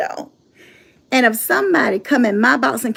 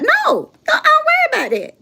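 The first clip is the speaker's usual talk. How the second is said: much higher put-on voice; mock worried voice; 13 semitones higher than usual. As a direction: play the fretful one. Mocking worried voice.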